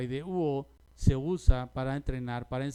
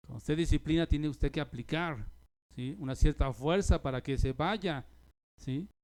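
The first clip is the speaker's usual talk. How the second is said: ordinary delivery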